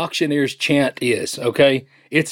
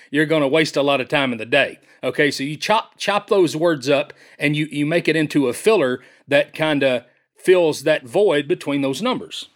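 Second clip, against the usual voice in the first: ordinary talk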